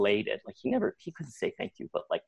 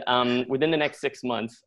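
usual speaking voice

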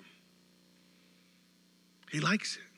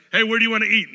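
brighter, louder casual question; loud friendly call; 6 semitones higher, 9 dB louder, much faster than usual. Retold or imitated. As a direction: call out to a friend about dinner. Acted dinner question dialogue.

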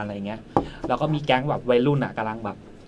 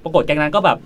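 ordinary talk, nothing marked

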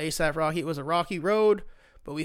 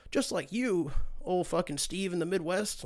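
mock-innocent Trump; 3 semitones higher than usual. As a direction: parody of a politician excusing himself. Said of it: "he finds a way to be like".

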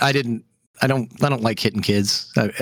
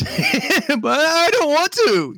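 put-on high, slow, reluctant kid whimper; whiny kid; 14 semitones higher, much slower than usual. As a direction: drag it out like a scared son. Son refuses swimming with sharks.

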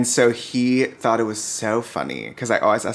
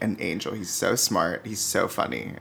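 ordinary delivery

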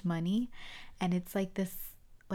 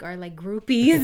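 loud, laughing delivery; amused and loud, laughing it out; 11 dB louder than usual. Real, not acted. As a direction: break into laughter on the words. Cracking up over childhood memory.

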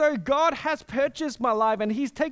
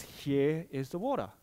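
softer and lower, unbothered answer; calm divine reply; 8 semitones lower, 5 dB quieter than usual. Retold or imitated. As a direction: simply hand it over, quietly. God calmly answering complainers.

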